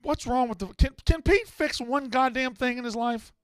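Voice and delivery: high, exasperated complaint. Real, not acted. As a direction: vent at the tech guy. Host frustrated at tech guy.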